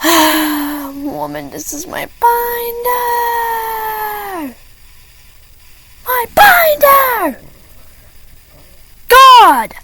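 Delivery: high, drawn-out whiny outburst at the teacher; exasperated yell. Retold or imitated. Kid whining at the teacher over her binder, high and drawn out. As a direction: stretch out my binder like you can't believe it.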